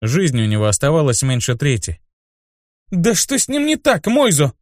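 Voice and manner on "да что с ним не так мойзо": much higher, squeaking fairy; squeaky fairy voice. Acted. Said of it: "пропищала фея".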